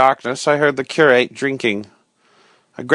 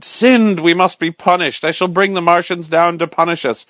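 frantic cry; 5 semitones higher than usual. Acted. The curate rants at the narrator in a frantic cry. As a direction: rave it out like a madman. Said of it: "he cried".

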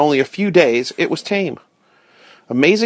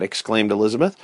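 ordinary delivery

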